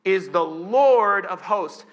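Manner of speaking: loud, slow scripture quote; proclaiming. Acted. Preacher quotes Isaiah on God's name, proclaiming. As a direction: proclaim it with full weight, drawing out the title. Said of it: "And then he says this".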